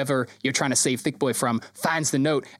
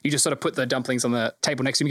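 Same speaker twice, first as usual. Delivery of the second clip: ordinary talk, nothing marked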